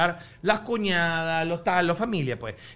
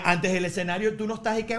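ordinary delivery

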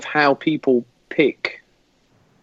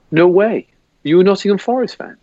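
higher-pitched mock incredulity; retold disbelief; 5 semitones higher than usual. Fellow passenger spots the Forest shirt, mock disbelief. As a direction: play the astonished stranger, pitched up.

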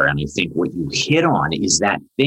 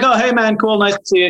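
breezy, much higher casual hello; offhand greeting; 4 dB louder than usual. Retold or imitated. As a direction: toss off a careless hey man.

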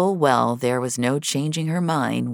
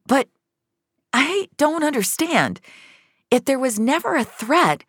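high, searching disbelief; ask, lost and bewildered; a stunned question to Frank; 12 semitones higher than usual. Acted. Finch confused, questioning.

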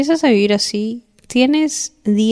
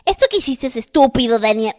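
self-scolding; 4 semitones higher than usual. Acted. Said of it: "dejemos de decir".

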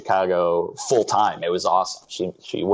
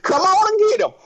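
much higher, louder prospector holler; old prospector; 3 dB louder than usual. Pretend. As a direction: sell cider like a crusty old miner. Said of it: "like an old prospector".

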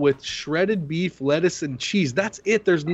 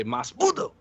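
lightly voiced George Lopez line; a hint of a comedian's catchphrase; George Lopez impression; 3 semitones lower than usual. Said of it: "As George Lopez would say".

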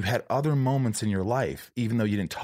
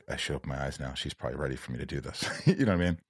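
ordinary talk

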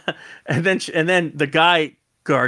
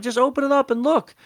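much higher plea; high pleading; 11 semitones higher than usual. Retold or imitated. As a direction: pitch it up, pleading. Pleading with the guard.

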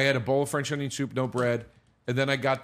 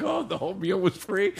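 mock excited; 5 semitones higher than usual. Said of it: "He got so excited".

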